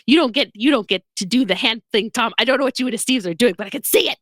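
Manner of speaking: mock mom telling off. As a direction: rattle off a mother's telling-off. Mother scolding son Tom.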